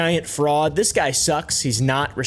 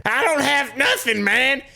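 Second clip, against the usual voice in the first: high put-on voice mocking the old man; mock Seagal voice; 11 semitones higher, slower than usual. Vlogger mocking Seagal's bust footage, high put-on voice. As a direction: parody the suspect's line, pitched up.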